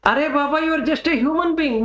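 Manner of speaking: high-pitched acted exasperation; mock-argument remonstrating; exasperated reasoning. Acted argument over identity labels.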